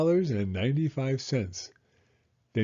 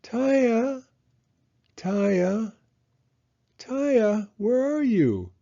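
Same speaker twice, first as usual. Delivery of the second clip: high, loud repeated calls; calling out; 10 semitones higher, 3 dB louder, slower than usual. A narrator's reading. Father calling through the store for his missing daughter, loud and high. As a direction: search the aisles for her. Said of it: "He said".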